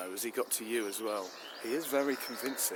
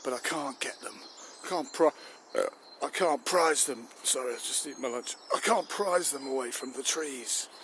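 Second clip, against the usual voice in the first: louder, higher frustrated complaint; exasperated; 3 semitones higher, 5 dB louder than usual. Acted. Army chief tells the minister about his soldiers, exasperated. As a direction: strain against the tree huggers.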